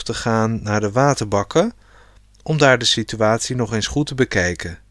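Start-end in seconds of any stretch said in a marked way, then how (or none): none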